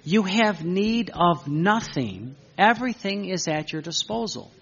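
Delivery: slow, deliberate, every word distinct; drawn-out, clear emphasis; very clearly. Shown as a demonstration. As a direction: spell it out slowly for the listeners. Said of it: "Very clearly".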